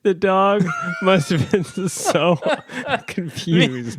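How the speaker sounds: cracking up at the dog's confusion; laughing. Real, not acted. Cohost laughing at the gym script, high and slow.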